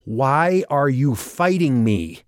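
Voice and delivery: high, slow, forceful demand; angry demand. A narrator's reading.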